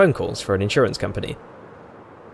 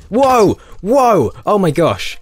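loud startled whoa; startled shout; 8 semitones higher, 7 dB louder, much slower than usual. Real, not acted. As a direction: jump out of your skin and yell. Player spawns in her face.

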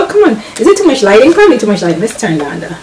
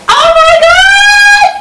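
very high, loud, slow shriek; real overjoyed scream; ecstatic shout; 4 dB louder, much slower than usual. Real, not acted. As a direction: scream it with joy. Sees the full page.

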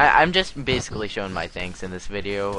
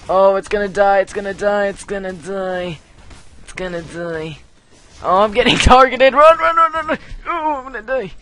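high, loud frantic panic; panicked yelling; 11 semitones higher, 9 dB louder than usual. Real, not acted. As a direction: yell in real alarm as you flee. Panicking while targeted in game.

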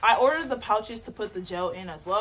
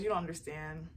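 ordinary delivery

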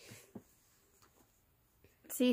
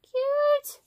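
squealing high with delight; high-pitched gushing over something adorable; overwhelmed by cuteness; 14 semitones higher, 3 dB louder than usual. Real, not acted.

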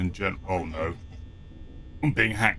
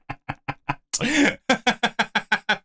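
laughing; 11 semitones higher than usual. Real, not acted. Laughing after the crash.

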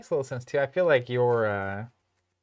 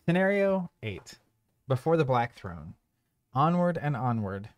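measured storybook narration; narrator voice; 5 dB quieter, slower than usual. Acted. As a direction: read it like a dark tale. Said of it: "Can you give me my narrator voice?".

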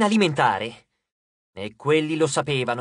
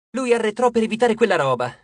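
plain reading voice

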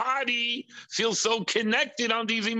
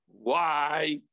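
ordinary delivery

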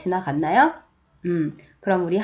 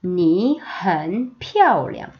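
very slow, each syllable drawn out; slow choral reading; slow read-along; 3 semitones lower, much slower than usual. Shown as a demonstration. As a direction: read slowly with the class. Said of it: "한 번 더".

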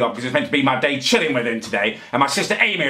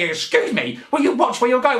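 mock indignant moan; 9 semitones higher than usual. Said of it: "moan at me".

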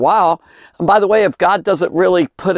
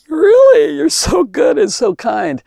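high, choked-up cry of gratitude, louder; tearful; 13 semitones higher, 4 dB louder than usual. Retold or imitated. Preacher retelling his prayer to God, tearful and much higher. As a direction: voice breaking with emotion at God's kindness. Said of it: "I'm like".

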